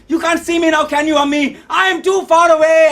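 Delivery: high, loud defiant yell; yelling into wind. Acted. Son yelling to his absent mother in the rain, loud. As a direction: call out across a great distance. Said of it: "I yelled into the cold wind".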